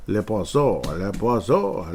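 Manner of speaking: slow, hammed-up French accent on Le Poisson; mock French accent. Joking to listeners about the Quebec pick, mock French accent. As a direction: drag it out like a cartoon French chef.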